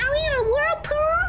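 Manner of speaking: high, fast panicked question; alarmed, voice rising. Acted. Panic in the whirlpool.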